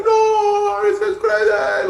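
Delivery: high, loud drawn-out cry of disbelief; going crazy. Retold or imitated. Comedian retelling his team finding the ring, crazed shouting. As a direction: wail it out like a whole crowd losing it. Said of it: "we all just go crazy".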